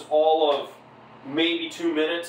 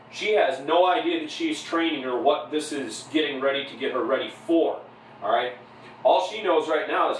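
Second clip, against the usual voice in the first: ordinary delivery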